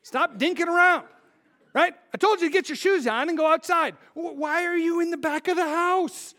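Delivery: father scolding Titus, exasperated; scolding parent. Retold or imitated. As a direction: scold a dawdling kid. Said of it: "I say that to my kids sometimes".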